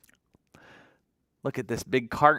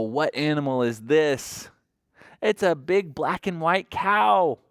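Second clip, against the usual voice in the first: ordinary delivery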